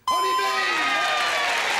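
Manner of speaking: high, loud, stretched-out shout; triumphant reveal. Real, not acted. Host revealing the answer, high loud drawn-out shout. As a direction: hold the words out like a big win.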